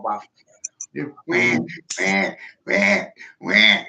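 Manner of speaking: mock bawling like a crybaby; teasing fake sobs; mock crying. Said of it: "they start crying".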